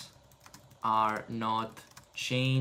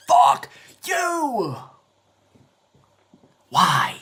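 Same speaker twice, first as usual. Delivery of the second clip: much higher, louder angry yell; furious shout; 4 semitones higher, 8 dB louder, much slower than usual. Real, not acted. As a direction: scream at the computer. Yells at the screen.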